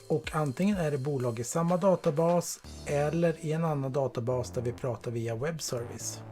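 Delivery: ordinary talk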